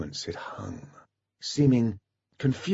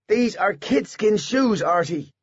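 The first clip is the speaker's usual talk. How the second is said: put-on child voice; 11 semitones higher, slower than usual. A narrator's reading. Miles child voice.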